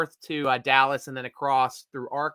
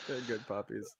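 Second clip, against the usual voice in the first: soft coo; 7 semitones lower, 18 dB quieter than usual. Real, not acted. Cooing over dogs.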